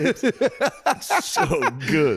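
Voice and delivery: the words break up into real laughter; laughing. Real, not acted. Cracking up mid-sentence.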